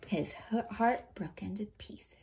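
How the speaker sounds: soft hushed whisper; storyteller whisper. A narrator's reading. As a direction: let it fall into a dramatic whisper. Sad climax whisper.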